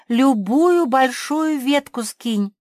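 high, loud, slow holler; yelling. A narrator's reading. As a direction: yell an order up high, slowly. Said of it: "и завопила". (громкий вопль)

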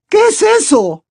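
high, loud alarmed question; terrified aunt's high-pitched cry; gasping fright. A narrator's reading. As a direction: gasp it out in a high panic. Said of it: "preguntó jadeando tía Petunia".

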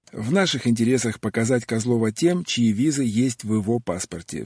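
usual speaking voice

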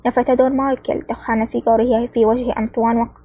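ordinary delivery

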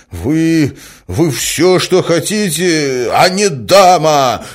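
high, loud, drawn-out insult; outraged shouting. A narrator's reading. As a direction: stumble into it, then cry out furiously. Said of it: "вскричал Мозгляков". (возмущённо, срываясь на крик)

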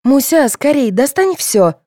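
high, loud pleading; urgent request. A narrator's reading. Contestant begging mother to empty pockets, urgent. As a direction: hurry her along. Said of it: "попросила Марина".

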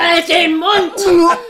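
furious yell through laughter and tears; wütend angeschrien. Acted. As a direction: shout him down, half laughing, half crying. Ehestreit, sie brüllt den Mann an. (brüllt lachend und weinend)

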